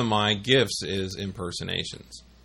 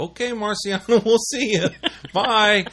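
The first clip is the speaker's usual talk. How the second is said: laughing; 11 semitones higher, 4 dB louder than usual. Retold or imitated. Boss answers prank call, laughing.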